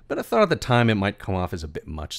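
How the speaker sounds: ordinary talk, nothing marked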